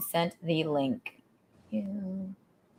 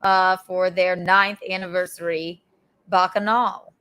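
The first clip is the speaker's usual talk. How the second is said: usual speaking voice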